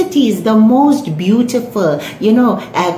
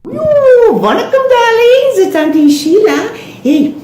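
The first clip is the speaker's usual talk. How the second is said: high, loud, stretched-out greeting; effusive Durban auntie; 14 semitones higher, 4 dB louder than usual. Acted. Auntie Sheila greeting her audience, effusive auntie. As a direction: gush hello in a high auntie voice.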